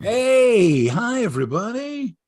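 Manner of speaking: high, swooping, stretched-out hello; showy greeting. Pretend. Co-host greets viewers on learning they are live, showy. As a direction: greet the audience big and high.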